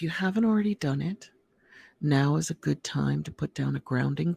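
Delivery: calm, measured voice with long pauses between phrases; guided meditation. Real, not acted. Host guiding listeners to relax and breathe, calm guided meditation. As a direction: lead them gently into relaxation, phrase by phrase.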